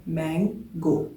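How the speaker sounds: Mango slowly, syllable by syllable; split into syllables. Shown as a demonstration. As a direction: break mango into मैं, then गो. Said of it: "दो दफ़ा vowel की sound निकालने के लिए खुला है".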